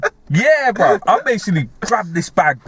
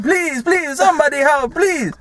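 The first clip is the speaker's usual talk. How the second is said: much higher mock pleading; 11 semitones higher than usual. Italian man begging bystanders for help, high mock plea. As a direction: beg like a desperate man, hammed-up. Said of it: "the Italian brother going".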